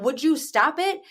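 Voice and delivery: put-on high, slow exasperated mom scolding her kid; exasperated parent scolding. Mom imitating herself scolding her kids, high and exasperated.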